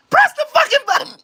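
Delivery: fast, very high mock shout; taunting yell. Daring someone to press the button, high taunting yell. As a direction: goad them in a hurried squeal.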